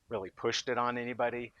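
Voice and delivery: ordinary talk, nothing marked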